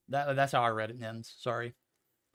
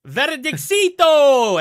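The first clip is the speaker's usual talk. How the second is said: very high, very loud, drawn-out mock call; imitating Extreme; 12 semitones higher, 15 dB louder, much slower than usual. Caster mimicking co-worker's name call, high loud stretched. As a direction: stretch the name out in a squeaky shout. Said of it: "as, uh, Extreme likes to say".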